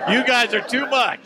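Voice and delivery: high, loud, through laughter; amused thanks to crowd. Pretend. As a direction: laugh it out over the applause. Laughing at fake studio audience applause.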